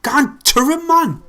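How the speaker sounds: very high, loud cry of frustration; exasperated shout. Real, not acted. Fan screaming at players for not getting to him, exasperated shout. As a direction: yell it at the screen.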